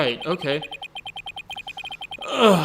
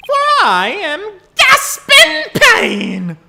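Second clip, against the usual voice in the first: dramatic villain reveal; 8 dB louder, much slower than usual. Acted.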